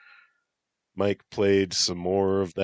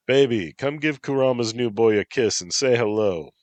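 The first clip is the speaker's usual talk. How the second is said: calling out; 6 semitones higher, 6 dB louder than usual. Acted. Tybalt calling to wife.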